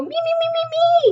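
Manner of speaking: put-on high, eager kid chant; silly eager kid. Shown as a demonstration. Teacher shows the class how not to call out, high eager chant. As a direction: mimic a child shouting to be picked. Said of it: "'cause that sounds silly".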